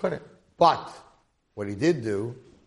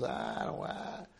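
reluctant whisper; 9 dB quieter, much slower than usual. Acted. Mohel refuses to enter.

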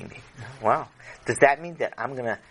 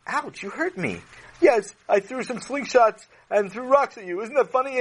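put-on bratty seven-year-old; 9 semitones higher than usual. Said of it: "a boy that was seven".

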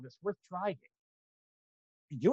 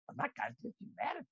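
ordinary talk, nothing marked

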